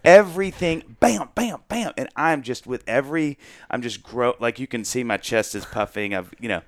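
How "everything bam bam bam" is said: pitched up, punchy boast; cocky bam bam. Retold or imitated. Bragging to his wife about the lunch table, cocky and punchy. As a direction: hammer each bam like landing a joke.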